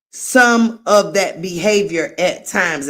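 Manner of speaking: ordinary delivery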